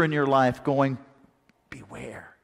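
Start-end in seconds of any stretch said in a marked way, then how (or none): 1.7-2.4 breathy hushed whisper; whispered warning. Acted. Rooster's warning voiced.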